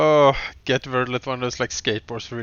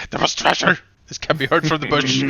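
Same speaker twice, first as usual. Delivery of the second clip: angry bush voice; 3 semitones higher, 8 dB louder than usual. Retold or imitated. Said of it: "the bush that just shakes with anger".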